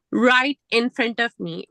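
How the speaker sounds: normal talking voice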